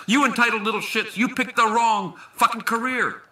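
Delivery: shouting, rising fury at the crowd; furious outburst. Acted. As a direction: blow up at the audience. Speaker loses temper at heckler.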